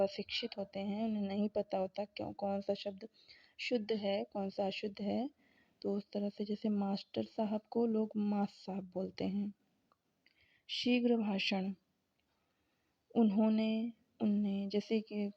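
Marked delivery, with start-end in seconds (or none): none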